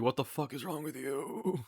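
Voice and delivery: muttered shocked thought; inner disbelief. Retold or imitated. Speaker thinking, shocked by coworker, softly. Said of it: "I'm like".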